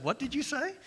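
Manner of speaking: high, hushed, pointed question; a hint of a stern God catching you out; God's stern reply. God answering a grumbling prayer, hushed and pointed. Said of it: "And God said".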